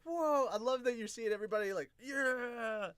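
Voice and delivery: ordinary delivery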